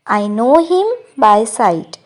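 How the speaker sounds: very slow, careful English model line; slow model sentence. Shown as a demonstration. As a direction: say each word slowly for learners. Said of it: "मैं उसे शक्ल से पहचानता हूँ". (धीरे-धीरे साफ़ बोला गया वाक्य)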